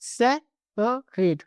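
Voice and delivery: Separate said twice, very slowly and deliberately; teacher drawing out separate syllable by syllable; slow repeated demo. Shown as a demonstration. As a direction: drill separate slowly for students to copy. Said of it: "separate बहुत important".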